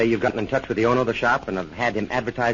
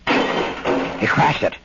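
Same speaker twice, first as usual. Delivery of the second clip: tense, slowed exclamation; sharp alarm at the break-in; urgent report; 8 semitones higher, 6 dB louder, much slower than usual. Acted. Lookout sees the window broken.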